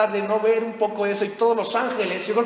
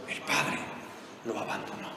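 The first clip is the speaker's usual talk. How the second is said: softer, dropped low whisper; hushed whisper; 9 semitones lower, 11 dB quieter than usual. Acted. Preacher telling the congregation, hushed and low. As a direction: let it fall to a breath.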